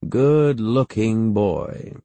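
slow, drawn-out appraisal; lightly voiced aunt sizing up a boy; appraising great-aunt. A narrator's reading. Great-aunt squeezing the boy's chin, slow and appraising.

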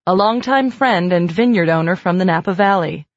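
ordinary delivery, nothing marked

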